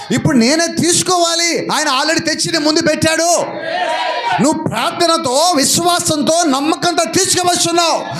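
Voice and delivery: high, ringing preacher's cry urging the flock; preaching at full force. Acted. Pastor rallying the congregation to move forward, at full force. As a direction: lift them up with each line, voice climbing. Preaching climax rising in pitch.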